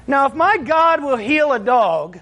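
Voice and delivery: slow, high preacher build; preaching. Acted. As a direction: lean into it slowly like a preacher. Preaching rises.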